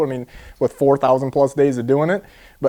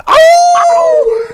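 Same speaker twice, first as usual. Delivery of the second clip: high, loud wolf howl; imitated howl; 5 dB louder than usual. Friend recalling the client's fishing howl, loud howl. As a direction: howl like a wild man.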